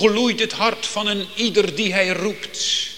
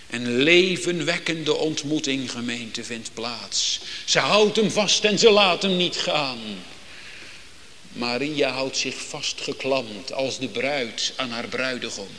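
ordinary talk, nothing marked

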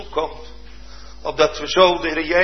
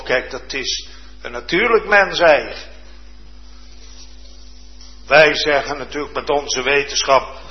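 ordinary talk, nothing marked

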